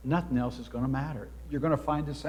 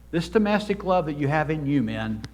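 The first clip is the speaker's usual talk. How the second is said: ordinary talk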